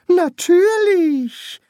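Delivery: very high, drawn-out priest voice. Acted. Priest assuring the king, high and drawn-out.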